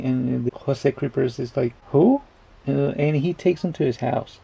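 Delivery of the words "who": high-pitched puzzled "who?"; baffled question. Retold or imitated. Jose Creepers answering the wacko stranger, mock baffled. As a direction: jump up high in mock bewilderment.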